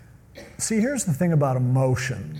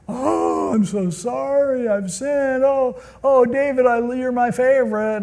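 high-pitched hammed-up remorse; put-on weepy contrition, pitched high; mock tearful apology; 7 semitones higher than usual. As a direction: wail an exaggerated apology in a raised voice. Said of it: "Saul was just faking it".

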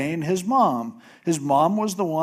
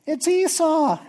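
a put-on voice, pitched way up; fake deep Esau voice; 14 semitones higher, 3 dB louder than usual. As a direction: fake being your brother badly. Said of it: "He goes".

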